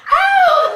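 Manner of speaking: loud, high startled ow; mock-startled squeal; shocked yelp. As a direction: yelp like a flustered lady. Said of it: "in her best Danville".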